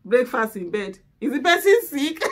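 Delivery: husband's words, breaking into laughter; laughing through quote. Retold or imitated. Wife retelling husband's breakfast joke, laughing. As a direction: quote him while cracking up. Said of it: "He say, my husband like".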